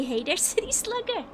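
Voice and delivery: high-pitched mock Valley Girl greeting; attempted Valley Girl. Streamer trying a chat-written line, mock Valley Girl accent. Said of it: "that's not a Valley Girl thing to say".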